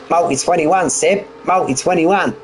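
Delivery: doing a bad Australian team-radio voice on "multi twenty-one"; mock Australian accent, quoting the team order; poor Australian accent. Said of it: "Sorry about the poor Australian accent there".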